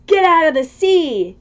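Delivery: ordinary delivery, nothing marked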